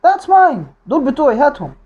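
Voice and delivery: high-pitched, possessive mock outburst; acted angry demand. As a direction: claim it and demand it back. Repeats Bill demanding the money. (مطالبة غاضبة ممثلة بنبرة عالية)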